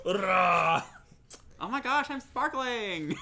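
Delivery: put-on high, squealy Valley Girl gush; Valley Girl accent. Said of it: "put on a Valley Girl accent".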